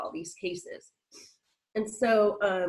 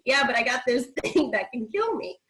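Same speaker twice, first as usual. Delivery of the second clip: higher, half-laughing comeback; joking retort; 4 semitones higher, 4 dB louder than usual. Retold or imitated. Patient joking with the doctor who calls her healthy, playful retort. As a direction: toss it back as a wry joke. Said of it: "I'm a little jokester".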